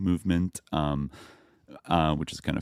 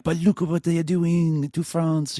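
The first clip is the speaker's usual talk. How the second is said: much higher, hammy French protest; French accent; 11 semitones higher than usual. Retold or imitated. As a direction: plead in a put-on French accent. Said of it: "being like".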